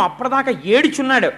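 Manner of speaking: ordinary delivery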